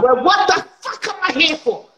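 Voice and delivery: high-pitched indignant outburst; inner outburst. Acted. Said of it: "That's in my head".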